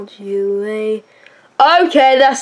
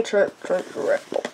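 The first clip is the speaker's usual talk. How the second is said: whispered; 6 semitones lower, 10 dB quieter, faster than usual. Pretend. Whispered rapper name.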